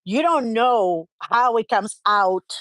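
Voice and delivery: usual speaking voice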